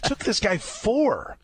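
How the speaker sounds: laughing. Real, not acted. Host laughing to his co-host about the draft pick. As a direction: laugh while saying it in disbelief.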